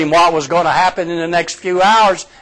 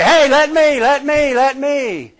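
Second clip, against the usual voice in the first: much higher, pleading let me; eager Roger; 10 semitones higher than usual. Retold or imitated. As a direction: beg like an excited kid. Roger eager to walk on water.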